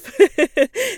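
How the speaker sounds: high rising laugh; bright laugh. Real, not acted. Laughing at her own bad day, high burst of laughter. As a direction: let out a real burst of laughter.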